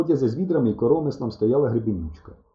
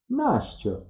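higher-pitched, teasing query; sly question; 9 semitones higher than usual. A narrator's reading. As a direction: ask it slyly, voice lifted. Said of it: "лукаво глянула вона на мене". (лукаво, з підозрою)

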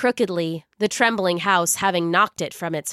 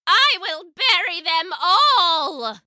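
cackling threat; 9 semitones higher, slower than usual. Acted. Witch laughs madly.

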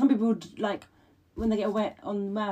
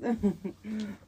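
awkward laugh; 3 semitones higher than usual. Real, not acted. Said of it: "I laugh and I'm awkward".

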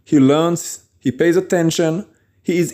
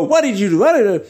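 higher-pitched, acted outburst, no real volume; mock angry yelling; 6 semitones higher, faster than usual. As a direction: imitate someone snapping at a spouse. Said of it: "you start yelling at them".

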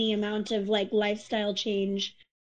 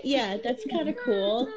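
ordinary delivery

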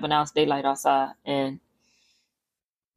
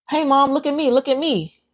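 put-on much higher, sing-song little girl's voice; child calling out; 7 semitones higher than usual. Little girl on the roof calling down to mom, high and playful.

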